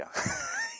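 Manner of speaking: quick high burst of laughter; short laugh. Real, not acted. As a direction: laugh at the joke. Râde la gluma lui Iisus. (râs scurt și înalt)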